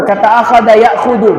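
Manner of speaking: ordinary talk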